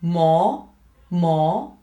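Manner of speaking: "Mo" climbing from low; rising tone. Shown as a demonstration. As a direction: glide each "Mo" upward. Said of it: "still rising".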